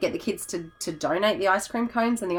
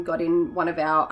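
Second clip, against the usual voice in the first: ordinary talk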